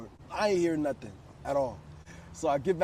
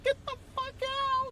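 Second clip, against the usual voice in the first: much higher, slow squeal of a mock shout; shrill mock yell. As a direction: squeal it way up high like her. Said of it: "She's like".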